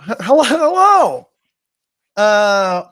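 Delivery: very high, loud mimicked hello; over-the-top soaring catchphrase hello; Nathan. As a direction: blast the greeting up high and hold it. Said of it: "That's my best Nathan impression".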